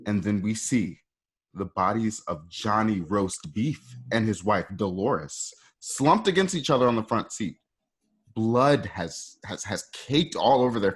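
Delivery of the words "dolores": normal talking voice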